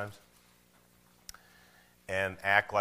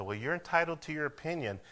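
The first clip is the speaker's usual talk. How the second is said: soft, higher-pitched mock concession; wishy-washy, placating little voice; meek backing down; 6 semitones higher, 3 dB quieter than usual. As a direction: imitate someone timidly giving in. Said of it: "slink away and say".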